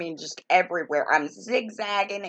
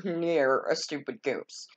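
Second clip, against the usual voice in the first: normal talking voice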